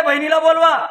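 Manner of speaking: high, loud summons; calling out. Retold or imitated. A wedding guest calling for the bride's sister, loud and high. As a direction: call across the wedding crowd.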